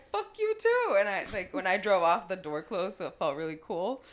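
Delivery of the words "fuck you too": much higher, louder, half-laughed retort; laughing mock yell. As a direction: fire it back with a laugh. Her retort retold again, laughing.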